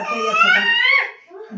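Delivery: put-on high, slow, crying wail of a trapped boy; wailing lament. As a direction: howl it out in despair, drawn out. Boy trapped by lions, wails. (रोते हुए ऊँची, धीमी विलाप भरी आवाज़)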